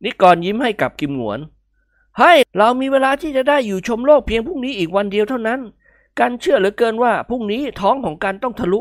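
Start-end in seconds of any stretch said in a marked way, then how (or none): 1.4-8.8 dramatic pessimism; pitched-up fatalistic sigh; gloomy young man. A narrator's reading.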